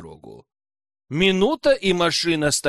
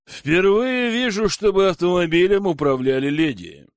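snide smirk; 4 semitones higher, 5 dB louder than usual. A narrator's reading. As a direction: tease her with a touch of snide amusement. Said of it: "ухмыльнулся ехидно мужчина".

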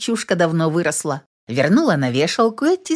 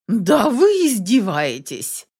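drawn-out, higher-pitched exclamation; indignant exclamation; 4 semitones higher, slower than usual. A narrator's reading. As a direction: exclaim it slowly, in mild indignation. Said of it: "воскликнула Елена Викторовна". (возмущённое восклицание)

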